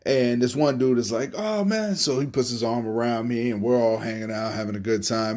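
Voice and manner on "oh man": excited German. Retold or imitated. German guy greets happily.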